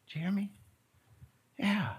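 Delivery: ordinary talk, nothing marked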